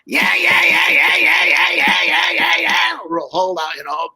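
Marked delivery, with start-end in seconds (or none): 0.1-3.0 high, loud dummy voice yelling; dummy shouting yeah. Retold or imitated. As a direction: belt it out as the puppet. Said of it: "the dummy Joey would just go".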